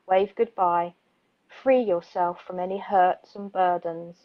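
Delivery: calm, measured poetry reading; poem reading. Acted. Radio host reads a soothing bedtime poem, calm poetry voice. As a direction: read it gently like a bedtime poem.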